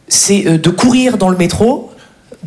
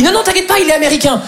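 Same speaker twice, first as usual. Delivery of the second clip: breezy; 8 semitones higher, faster than usual. Retold or imitated. Producer reassuring.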